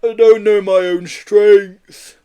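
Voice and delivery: loud, high, slow apology; sheepish excuse from a big creature; guilty yeti. A narrator's reading. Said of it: "mumbled a guilty Marbo".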